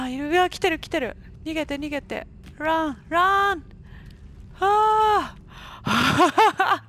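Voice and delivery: loud panicked yelling; being chased. Real, not acted. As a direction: scream while running away. Monster chasing, she runs.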